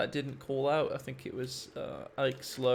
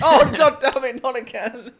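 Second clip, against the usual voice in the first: loud, high laughing groan; laughing exasperation; 7 semitones higher, 13 dB louder than usual. Real, not acted. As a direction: curse through laughter. Another rude name read out.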